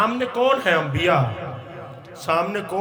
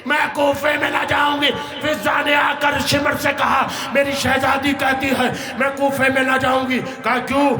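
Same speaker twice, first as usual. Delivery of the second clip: impassioned lament preaching; 5 semitones higher than usual. Acted. Preacher voicing captives and their captor at Kufa's gate, impassioned lament. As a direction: preach the exchange with mounting urgency. Preacher dramatizes the exchanges at Kufa.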